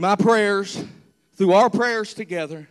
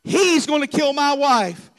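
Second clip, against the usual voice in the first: panicked cry, higher and louder; a desperate, raised complaint to God; frantic plea; 7 semitones higher, 4 dB louder, slower than usual. Retold or imitated. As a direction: plead with heaven in a panic.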